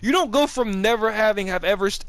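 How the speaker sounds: normal talking voice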